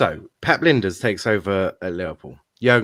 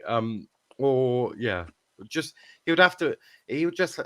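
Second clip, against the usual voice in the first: normal talking voice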